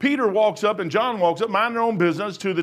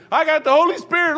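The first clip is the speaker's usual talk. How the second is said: high, loud fake religious fervor; hammed-up Spirit-filled outburst; mock tongues-speaker; 9 semitones higher, 5 dB louder than usual. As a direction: proclaim it like a showy charismatic. Mocks showy speaking in tongues.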